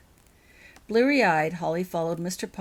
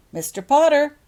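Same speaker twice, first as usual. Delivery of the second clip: louder, higher call across the yard; 10 semitones higher, 12 dB louder than usual. Acted. Laurel calls Harry over from the front steps, loud call. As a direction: call him back from the steps. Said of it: "called out Laurel".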